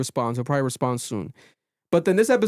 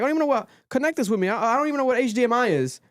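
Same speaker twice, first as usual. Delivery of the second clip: put-on quick, higher airheaded girl voice; 8 semitones higher, faster than usual. Alicia admitting she knows nothing about tech, ditzy and quick. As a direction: rattle it off like a clueless girl. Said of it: "She's like".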